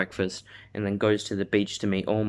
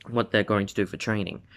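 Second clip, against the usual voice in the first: ordinary delivery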